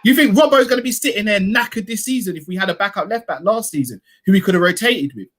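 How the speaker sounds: normal talking voice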